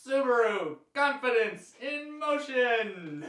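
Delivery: high, slow, hammed-up ad pitchman; fifties salesperson. Co-host does the requested salesperson voice, hammed-up. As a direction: sell it like a cheesy old car commercial. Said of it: "Just, just do the voice".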